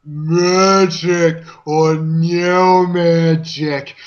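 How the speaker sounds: dragged out, slow and rising. Acted. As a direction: stretch each word out slowly. Hero defies villain, dragged out.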